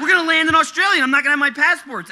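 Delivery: high, loud comic panic; retold panic at full pitch; freaking out. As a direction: act out a frantic meltdown. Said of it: "I'm, like, freaking out".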